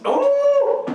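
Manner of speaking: high loud excited ooh. Real, not acted. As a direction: cheer a correct guess. Right guess, celebrating.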